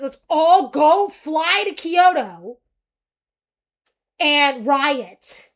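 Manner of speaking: slow, loud rallying cry. Pretend. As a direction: whip up the crowd. Rallying friends to protest.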